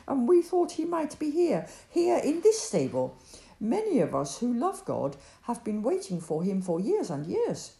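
nervous explaining. Acted. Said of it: "looked at the couple nervously".

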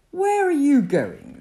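high, loud camel's question; inquiring camel. A narrator's reading. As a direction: call out the question loudly as the camel. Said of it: "the camel inquired".

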